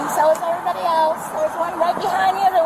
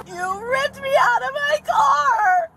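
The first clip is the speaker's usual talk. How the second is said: high, slow crying shout; crying accusation; 7 semitones higher, slower than usual. Real, not acted. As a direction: wail it out, accusing.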